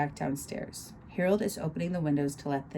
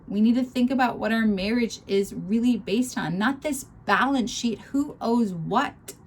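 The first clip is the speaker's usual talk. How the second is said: whining; 7 semitones higher, 5 dB louder than usual. Acted. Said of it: "comes out like whining".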